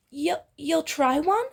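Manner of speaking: high, slow stammered disbelief; can't believe he agreed; hopeful stunned stammer; surprised. Acted. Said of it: "head snaps up in shock".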